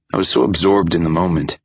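plain reading voice

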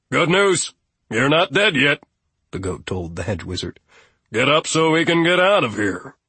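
dry, resentful billy goat; a grumpy goat settling scores; sardonic goat voice. Acted. Goat gloats at wizard.

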